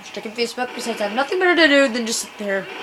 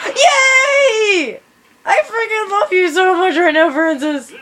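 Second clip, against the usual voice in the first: hyper squealing; 5 semitones higher than usual. Real, not acted. Gamer cheering Francis for saying he hates something, giddy squeals. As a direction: cheer like you can't contain yourself. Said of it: "really freaking hyper".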